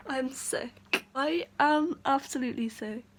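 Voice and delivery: usual speaking voice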